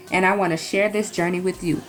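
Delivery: ordinary delivery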